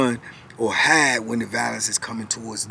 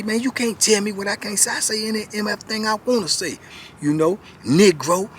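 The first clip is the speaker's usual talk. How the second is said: higher, heated mock defiance; retold angry comeback; 8 semitones higher, 3 dB louder than usual. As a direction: throw the insult back, hammed-up. Retold quarrel by the horseshoe pit.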